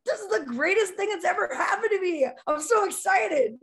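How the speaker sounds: high, loud, laughing gush, slowing on the last words; mock thrilled fan. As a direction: ham up a fan overjoyed to be hurt. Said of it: "He goes".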